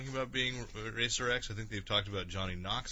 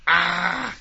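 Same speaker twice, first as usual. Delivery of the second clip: monkey shriek with closed lips; 6 semitones higher, 12 dB louder than usual. Pretend. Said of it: "can you talk without moving your lips?".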